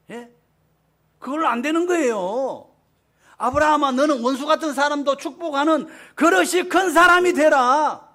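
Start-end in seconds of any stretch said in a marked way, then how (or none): 3.4-8.1 louder, higher voice of God calling Abraham; God's command. Acted. (하나님이 아브라함에게 명령하듯 크고 높게 선포)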